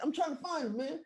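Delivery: high mock whine; defensive excuse. Storyteller re-enacts answering pushy friend, defensive. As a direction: protest in a squeaky, pleading pitch.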